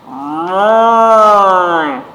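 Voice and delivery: loud, drawn-out moo; cow moo. A narrator's reading. Storyteller mooing as Cow, long and loud.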